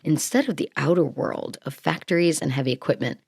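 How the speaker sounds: usual speaking voice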